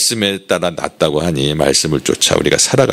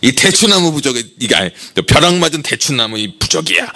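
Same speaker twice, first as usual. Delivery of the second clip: 친구들에게 으스대듯 높은 목소리로; 남편의 자랑을 흉내 내듯; 6 semitones higher than usual. Retold or imitated. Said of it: "자랑을 한 거예요". (자랑하는 말투)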